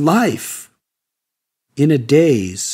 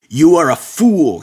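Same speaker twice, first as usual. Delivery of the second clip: a loud, spat-out insult; angry hiss; 6 dB louder than usual. Acted. Sadhu sneering at the seeker, loud angry hiss. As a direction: sneer it right at him, louder. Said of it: "he hissed".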